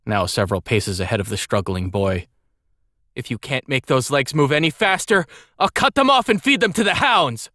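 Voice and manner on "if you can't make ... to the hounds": scornful threat climbing to a high yell; contemptuous tirade. A narrator's reading. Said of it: "assailing her butler with an unending string of contemptuous words".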